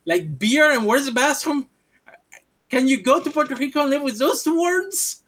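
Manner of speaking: high-pitched, indignant questioning; incredulous. Retold or imitated. Retort to someone claiming Spanish from two words, incredulous. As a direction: challenge them, voice climbing in disbelief.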